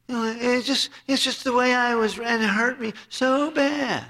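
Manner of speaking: preacher mocking a bitter person's excuses, hammed-up whine; whiny excuse. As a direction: whine like someone nursing an old hurt.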